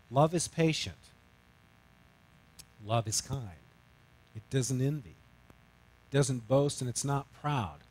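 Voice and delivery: soft, measured Bible recitation; scripture reading. Retold or imitated. Wife quoting First Corinthians to her husband, softly recited. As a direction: recite each line gently with pauses. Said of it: "She quotes First Corinthians".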